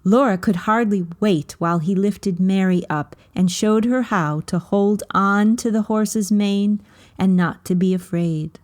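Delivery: ordinary delivery, nothing marked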